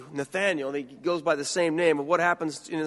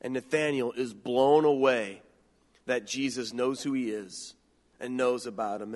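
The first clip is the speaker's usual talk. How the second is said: ordinary talk, nothing marked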